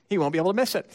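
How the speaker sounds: normal talking voice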